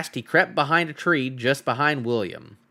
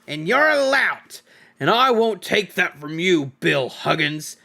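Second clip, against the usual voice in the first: quarrelling troll Bert; 4 semitones higher than usual. Acted. Troll Bert picks a fight with William, angry. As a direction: insult him like a brawling troll.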